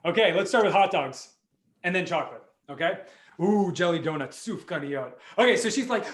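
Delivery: usual speaking voice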